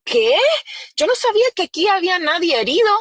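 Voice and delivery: high, startled protest; a retold father caught off guard; shocked disbelief. Father hearing of the injury, high and shocked.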